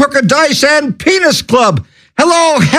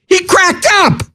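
silly voice; 8 semitones higher than usual. Acted. Punchline in silly voice.

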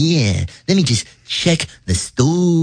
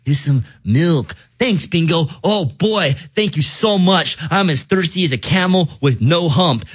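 normal talking voice

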